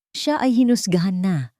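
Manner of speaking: ordinary delivery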